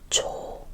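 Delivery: "조" with a small, closed-mouth O. Shown as a demonstration. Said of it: "this is a closed mouth O".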